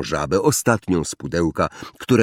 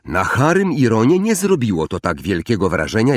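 usual speaking voice

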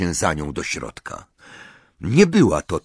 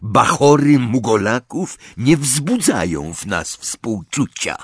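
growled; 5 semitones higher than usual. A narrator's reading. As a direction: drag it out with snarling contempt. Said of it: "warknął Yaxley".

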